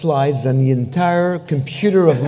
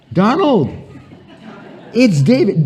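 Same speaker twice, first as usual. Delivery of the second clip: chummy phone greeting; 6 semitones higher than usual. Acted. Calling the President in joke.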